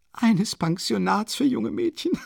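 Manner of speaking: a desperate, proud widow ranting; agitated Katharina. A narrator's reading. Narrator voices Katharina Iwanowna. (erregte, verzweifelte Mutter, höher gesprochen)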